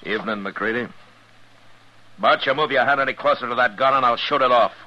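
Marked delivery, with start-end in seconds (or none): 2.2-4.7 stern warning. Acted. Marshal warns gunman to drop gun.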